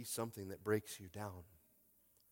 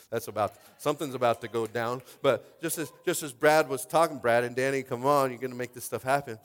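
loud, driving pulpit voice; full-force preaching; 11 dB louder than usual. Acted. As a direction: preach it out to the room.

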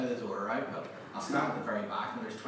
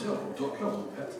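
higher-pitched imitated Yorkshire greeting; Yorkshire accent; 8 semitones higher than usual. Visitor imitating Sheffield locals' greeting, in a Yorkshire accent. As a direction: put on a friendly Yorkshire accent. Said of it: "it's a great accent".

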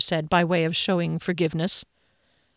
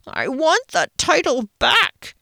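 high, slow whinge; whinging; 9 semitones higher, 3 dB louder, slower than usual. A narrator's reading. Phoenix whinges to Rickenbacker about the lost title. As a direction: whine it like a sulky child. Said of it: "he whinged".

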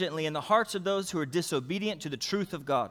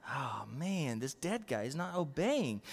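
much softer, lower grumble aside; mock aside; 4 semitones lower, 9 dB quieter than usual. Pastor grumbling aside about the boy, soft and low. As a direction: mutter it to the crowd.

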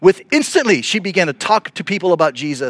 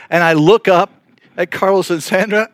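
ordinary delivery

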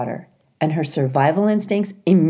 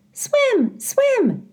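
put-on much higher, eager chirping child; excited little gosling. A narrator's reading. Brightbill recognizing Loudwing, high gosling voice. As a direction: squeak the name like an excited chick.

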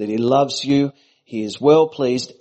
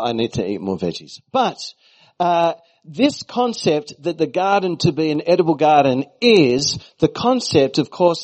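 ordinary talk, nothing marked

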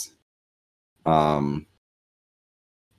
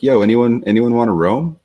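higher, louder eager shout-out; casual call-out; 5 semitones higher, 4 dB louder than usual. Retold or imitated. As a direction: toss it out to the whole channel. Amelia calling out in Discord.

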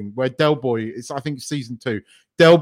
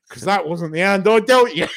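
higher voice giving way to laughter; retold punchline, laughing; 8 semitones higher than usual. Retelling Del's comeback to Boise, laughing. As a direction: imitate the comeback while cracking up.

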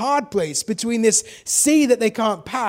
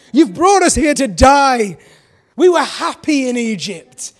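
louder, higher mock whine; dramatic complaining Israelites; 3 semitones higher, 7 dB louder than usual. Israelites whining at Moses, hammed up. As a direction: pile on the melodrama. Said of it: "They get very dramatic".

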